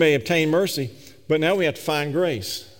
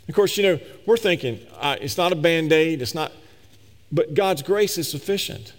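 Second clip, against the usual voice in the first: normal talking voice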